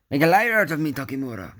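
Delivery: fast, high, loud outburst falling away; playfully indignant reproach; mock-dramatic scolding. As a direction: scold the toy with hammed-up outrage. Mock-scolds the figure for failing.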